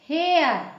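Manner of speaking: हेयर said with ए gliding into अ; teacher demo. Shown as a demonstration. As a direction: stretch हेयर so the ए-अ glide is clear. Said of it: "ए, अ का sound निकला".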